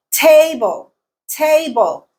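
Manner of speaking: table with TA pitched high, then falling; first-syllable stress. Shown as a demonstration. Teacher showing learners word stress, table stressed on TA. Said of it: "Te is the stressed syllable".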